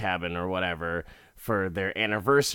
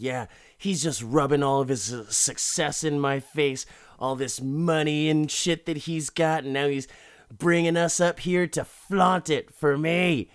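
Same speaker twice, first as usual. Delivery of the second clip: higher, put-on aggrieved husband voice; mock heated rant; 5 semitones higher than usual. Husband rants to wife about rich friend, mock heated. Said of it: "he's just mad the whole time. He's heated".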